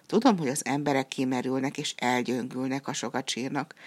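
plain reading voice

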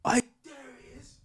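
whispered, high and menacing; whispered menace; retold whisper. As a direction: hiss it like someone spotting you. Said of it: "all I heard is".

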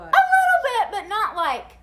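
high, loud laughing admission; sheepish laugh. Real, not acted. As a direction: laugh it off, caught out. Admits riding his tail, laughing.

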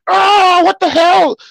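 very high, loud shocked cry; shocked yell. Retold or imitated. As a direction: yelp it out, caught off guard. Hit by spirit bomb.

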